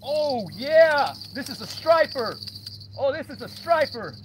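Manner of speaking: high, excited, breathless hollering; whooping while reeling one in; fighting a fish. Real, not acted. Reeling in a striper.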